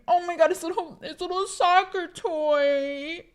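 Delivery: tearful awe. Real, not acted. Moved by old dog's toy photo.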